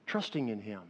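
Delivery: usual speaking voice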